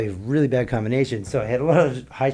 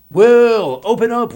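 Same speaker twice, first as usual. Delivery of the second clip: mock yell through the door, higher and louder; imitated security shout; 10 semitones higher, 11 dB louder, slower than usual. Hotel security yelling through the door at Will, mock shout. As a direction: bark it through a locked door like a guard.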